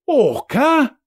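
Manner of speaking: high, loud astonishment; boy exclaiming in disbelief; amazed lad; boy's exclamation. Acted. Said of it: "exclaimed the boy".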